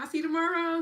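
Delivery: high, loud and bright reply; eager farewell. Real, not acted. Host answering a viewer's goodbye from the chat, eager and loud. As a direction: answer back with a joyful lift.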